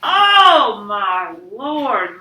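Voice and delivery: loud, drawn-out horrified cry; Mama's scream. A narrator's reading. Mama finds her son stuck, screaming. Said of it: "Mama screamed".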